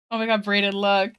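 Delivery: ordinary talk, nothing marked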